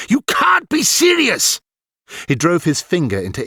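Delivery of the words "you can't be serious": high, slow, louder shouted disbelief; outraged shout. A narrator's reading. Galland scolding Ilian, high slow outraged shout. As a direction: blast his disbelief at the boy.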